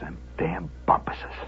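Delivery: low, barely voiced grumble; muttered curse. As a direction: curse softly in defeat. Defeated old man mutters curse.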